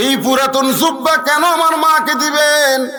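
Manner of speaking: high, loud chanted lament; acted, high-pitched tearful appeal; tearful protest. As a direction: wail the question as a daughter's plea. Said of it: "Fatema কান্দে আর বলে".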